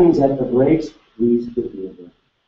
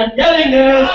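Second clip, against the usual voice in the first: higher and louder, pumped up; more energy; 10 semitones higher, 6 dB louder than usual. Shown as a demonstration. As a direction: give it full energy for the room. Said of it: "that was about fifty-four percent energy".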